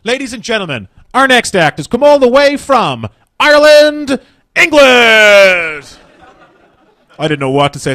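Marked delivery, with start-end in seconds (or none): 0.1-6.0 much higher, louder, slow announcer build; hammed-up MC introducing the act; MC introduction. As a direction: boom it out like a club MC. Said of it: "She introduced me to the crowd as".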